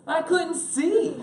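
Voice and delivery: high, loud comic cry; hammed-up exasperated explanation; exclaimed punchline. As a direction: shout the punchline, stretched out. Shouted punchline of his scowl story.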